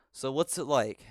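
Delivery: mock interviewer. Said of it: "do an interview and be like".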